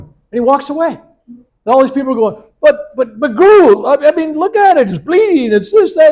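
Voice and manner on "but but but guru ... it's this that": much higher and louder, pleading; frantic protest. Retold or imitated. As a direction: protest in a shrill panic. Crowd protesting to guru.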